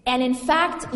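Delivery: usual speaking voice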